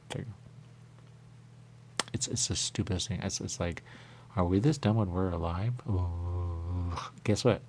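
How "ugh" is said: ordinary talk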